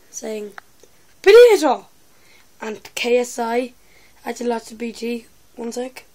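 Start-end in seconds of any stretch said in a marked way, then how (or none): 1.2-1.9 shouted high Potato!; Final Boss impression. Teen doing Final Boss in a vlog, loud high Potato! shout. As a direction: yell Potato! high and loud like a hyped gamer. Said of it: "I'd like to do a video saying".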